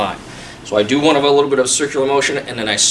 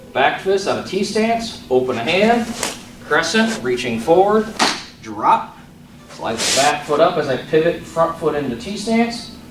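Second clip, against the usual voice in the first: crisp called-out form commands; sharp martial arts commands; 4 dB quieter than usual. Shown as a demonstration. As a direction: call each move like a drill. Calls form movements over music.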